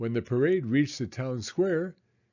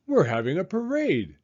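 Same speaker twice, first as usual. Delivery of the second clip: higher, excited announcement; exclaimed; 6 semitones higher than usual. Acted. Emily Elizabeth tells Sam about the parade, excited. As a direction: burst out with the news. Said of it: "Emily Elizabeth exclaimed".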